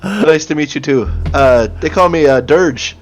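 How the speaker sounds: put-on chatty introduction in a Minnesotan accent. Dirge introducing himself to new allies, Minnesotan accent. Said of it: "the Minnesotan accent is so hard to do".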